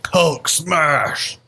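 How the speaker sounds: lightly voiced smashing brute; Hulk. Player cheers on a slam attack, hint of brute voice.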